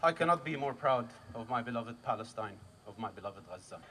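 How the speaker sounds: low, softened voice, sinking at the end; quiet pride. Real, not acted. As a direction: drop down low and soft, with reverence. Tender pride in homeland.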